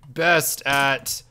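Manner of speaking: slow, drawn-out pompous impression; Grazer. Demanding gold blocks as Grazer, hammed-up slow impression. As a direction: proclaim it very slowly, badly faking someone else. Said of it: "I'll be Grazer".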